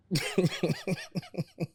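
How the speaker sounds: a long, high burst of laughter; big laugh. Real, not acted. To friends after the gaffer said go home, a long high laugh. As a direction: crack up at the punchline.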